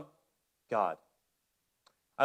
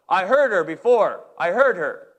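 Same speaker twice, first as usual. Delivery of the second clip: louder, curt referee reply; referee's retort; 3 semitones higher, 7 dB louder than usual. Retold or imitated. Referee answering the coach, loud curt retort. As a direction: brush him off as the ref. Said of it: "he goes".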